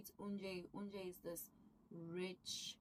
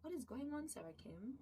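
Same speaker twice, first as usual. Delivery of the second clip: surprised; 6 semitones higher, 5 dB quieter than usual. Retold or imitated. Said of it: "and we're like".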